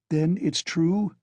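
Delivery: high, slow, alarmed question; alarmed cry. A narrator's reading. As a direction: dread confirmed. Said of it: "cried Pulcheria Alexandrovna".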